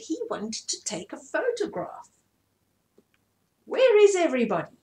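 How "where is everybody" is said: fast, high, loud calling out; eager call. Acted. Scarecrow calling the animals for a photo, fast and loud. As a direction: hurry everyone along. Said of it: "said Track".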